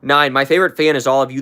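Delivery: ordinary delivery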